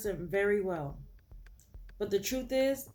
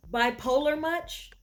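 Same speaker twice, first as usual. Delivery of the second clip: higher, louder mocking quip; sarcastic jab; 6 semitones higher, 5 dB louder than usual. Real, not acted. Reader mocks Kian's mood swing, sarcastic and loud. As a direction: tease him with a sarcastic jab.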